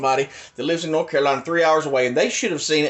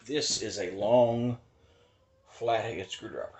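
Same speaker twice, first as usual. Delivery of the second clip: soft, slow deadpan demo; quiet deadpan; 5 semitones lower, 8 dB quieter than usual. Real, not acted. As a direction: say it low and smug. Quiet deadpan analogy demonstration.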